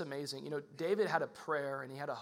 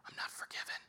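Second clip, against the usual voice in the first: put-on frightened child's whisper; a kid's scared secret; 10 dB quieter, faster than usual. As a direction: breathe it in fear, no voice. Childhood fear retold.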